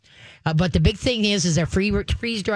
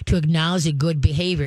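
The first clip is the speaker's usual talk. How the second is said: ordinary talk, nothing marked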